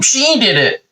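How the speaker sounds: high, drawn-out mock whine; wimpy tattletale. Adam blaming Eve to God, high and wimpy. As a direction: blurt the blame in a wimpy high voice. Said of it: "wimpy little bitch that he is".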